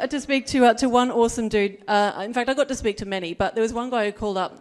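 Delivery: usual speaking voice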